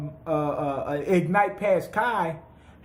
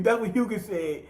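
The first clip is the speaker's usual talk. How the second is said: usual speaking voice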